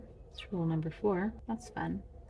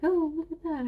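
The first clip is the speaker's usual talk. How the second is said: mock discovery; 10 semitones higher, 4 dB louder, faster than usual. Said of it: "we'll come up and be like".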